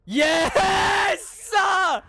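high, drawn-out cheer; triumphant yell. Real, not acted. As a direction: yell it in pure celebration. Cheering the fight.

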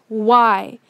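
normal talking voice

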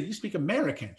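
delivered with a laugh through it; laughing punchline. Retold or imitated. Presenter telling the Texan his language, half laughing. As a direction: land the joke while still chuckling.

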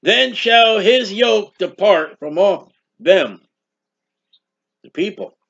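slow, solemn preacher's reading; measured pulpit reading of scripture. Acted. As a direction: read the verse out slowly and weightily. Reads scripture aloud.

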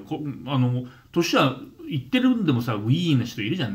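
ordinary talk

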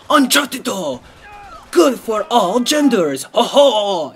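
high, slow hyped ad voice; mock ad slogan. Gamer pitching Uncharted to all genders, mock ad voice. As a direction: sell it like a cheesy commercial.

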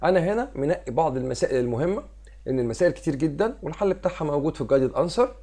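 ordinary delivery

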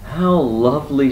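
high, loud, pleased bear; acted delight.

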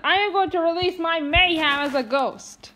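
ordinary talk

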